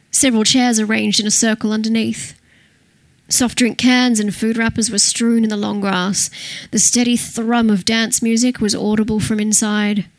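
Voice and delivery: ordinary delivery, nothing marked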